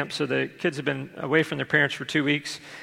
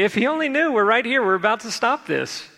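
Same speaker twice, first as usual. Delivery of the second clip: much higher, louder, breezy and pleased with itself; mock cheerful parent; 7 semitones higher, 3 dB louder than usual. Parent at the gate mocking a smug, much higher cheerful voice. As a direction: sing it out like a smug parent who knows better. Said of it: "smile and laugh and say".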